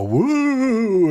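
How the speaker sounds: high hooting ghost woo. Retold or imitated. Imitating a ghost singing woo in a song. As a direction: sing it like a ghost. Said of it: "and they go".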